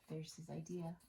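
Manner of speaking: plain reading voice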